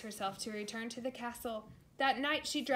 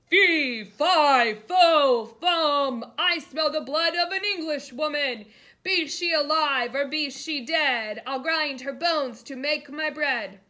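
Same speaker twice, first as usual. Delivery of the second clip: loud, higher giant's chant; booming giant; 5 semitones higher, 14 dB louder, slower than usual. A narrator's reading. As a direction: bellow each word like a hungry giant.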